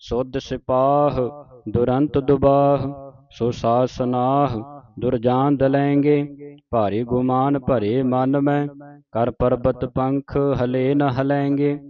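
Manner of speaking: measured chant-like verse reading; sustained teaching recitation; scripture recitation. Shown as a demonstration. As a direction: recite in phrases, holding the long vowels.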